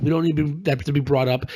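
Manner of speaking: ordinary delivery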